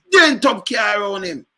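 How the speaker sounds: excited shout. Real, not acted. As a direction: yell it out in the heat of the rant. Excited rant about crashing car.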